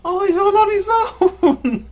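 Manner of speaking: high, loud, drawn-out wail of pity. Real, not acted. Streamer distressed for a lone baby wolf, high pitying wail. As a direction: wail it slowly, close to crying.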